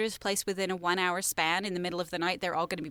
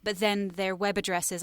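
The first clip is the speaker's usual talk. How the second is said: usual speaking voice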